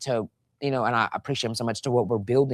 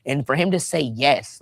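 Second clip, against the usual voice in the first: ordinary talk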